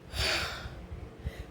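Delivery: long, heavy exhale as if winded; out-of-breath sigh. Acted. Vlogger showing first-trimester breathlessness after walking to the restroom, winded sigh. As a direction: act out being winded with one big breathy sigh. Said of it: "I'm gonna be out of breath".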